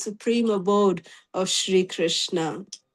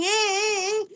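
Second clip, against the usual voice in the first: high, light mock shout; mock crowd shouting; 7 semitones higher than usual. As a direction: imitate a crowd calling out. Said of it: "everyone started shouting".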